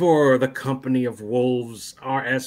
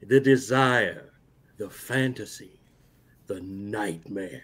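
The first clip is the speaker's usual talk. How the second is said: trailer voice; 4 semitones lower, slower than usual. Acted. Reading dramatic movie taglines aloud.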